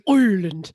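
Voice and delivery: "Ireland" with a big "oi" at the front; loud mock stage-Irish "Ireland", said as Oi-erland; bad Irish "oi". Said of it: "the most important thing in an Irish accent".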